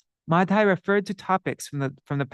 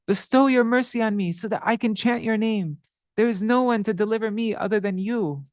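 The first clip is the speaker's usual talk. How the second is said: higher, earnest plea to the Lord; the sinner Madhai begging the Lord for mercy, higher pitched; pleading sinner; 5 semitones higher than usual. Acted. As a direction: beg for mercy, voice lifted.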